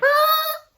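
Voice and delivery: very high, loud falsetto shriek; a hammed-up high-pitched wail; mock scream. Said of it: "she screams, like".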